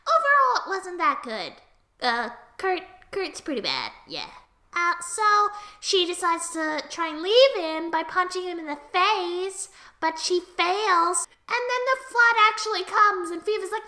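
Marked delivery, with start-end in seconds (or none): none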